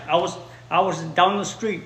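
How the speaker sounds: usual speaking voice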